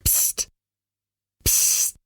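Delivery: short whispered hiss for attention; hushed psst. Acted. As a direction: quietly get a sleeper's attention. Someone quietly waking the sleeper.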